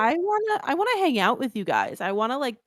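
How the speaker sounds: very high, pleading put-on voice; mock eager guards. Guards asking prisoners to hang out, mock eager and high. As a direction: pitch it way up like an overeager pal. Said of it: "The guards were like".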